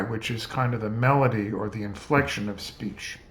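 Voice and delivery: usual speaking voice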